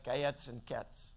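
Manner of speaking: "gayetz and ketz" as two near-rhyming Hebrew words, said low and soft; low, offhand attempt at Hebrew "gayetz and ketz"; tentative Hebrew pronunciation. Shown as a demonstration. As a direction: say "gayetz" and "ketz" as Hebrew sound-alikes, both ending in a ts sound. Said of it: "not very good at pronouncing it".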